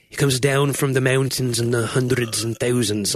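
Scottish accent, lightly put on; lilting Scottish accent. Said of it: "in my Scottish, I would say".

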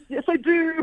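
high, bright mock retort; emphatic yes. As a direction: fire back a delighted yes. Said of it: "I'm like".

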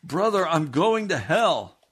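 high, loud despairing wail; desperate cry. Retold or imitated. Jim in the wagon wailing to the preacher, high and loud. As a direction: cry out in terror.

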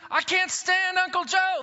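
high, exasperated cry of dislike; sudden high-pitched complaint; fed up outburst. Acted. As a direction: blurt it out like you've had enough. Congregant voiced venting about coworker.

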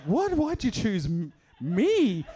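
high, loud disbelieving cry; incredulous. Retold or imitated. Narrator asking the homeless man in disbelief why him. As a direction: sound baffled he picked you.

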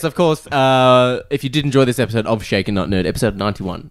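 usual speaking voice

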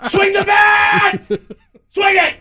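high, slow, shouted through a laugh; mock translator screaming. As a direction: bellow it like an overexcited interpreter.

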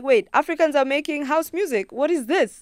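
amazed discovery. Retold or imitated. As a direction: relive the shock of first hearing it. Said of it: "and I was like".

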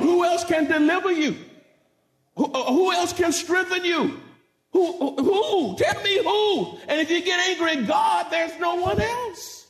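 high, driving sermon delivery; full-force preaching. Real, not acted. Preacher warning folk against anger at God, full-force preaching. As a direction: press the congregation with rising rhetorical questions.

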